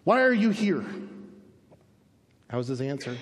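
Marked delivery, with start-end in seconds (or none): 0.0-0.9 higher, firm challenge; pointed, raised-voice question; messenger of God. Acted. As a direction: put the question forcefully from on high. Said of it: "he says".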